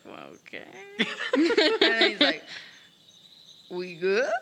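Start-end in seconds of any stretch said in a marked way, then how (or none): 0.0-0.6 very low, soft, doubtful aside; sidelong reluctant okay. Acted. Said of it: "looks sideways, like". 3.7-4.3 "We good?" with a rising, tentative end; questioning. Acted. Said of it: "Question mark?".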